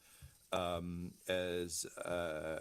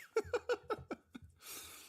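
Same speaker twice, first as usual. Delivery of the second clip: long breathy laugh; amused, wheezy laugh. Real, not acted. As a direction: laugh it out, mostly air. Amused at the pending roll.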